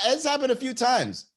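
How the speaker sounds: normal talking voice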